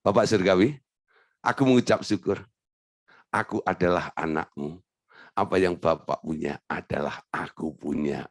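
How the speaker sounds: low, soft, calm prayer; confident child's prayer. Shown as a demonstration. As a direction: speak to the Father as a trusting son. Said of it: "kan dirubah konsepnya".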